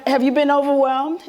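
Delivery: ordinary talk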